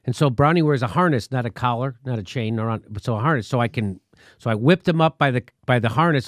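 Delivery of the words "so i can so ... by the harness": normal talking voice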